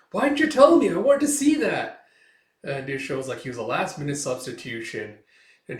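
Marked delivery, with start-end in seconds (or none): 0.1-2.0 very high, louder pouting protest; loud high whine. Acted. Urara scolds her friend for not telling, loud high whine. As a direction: whine like you were left out.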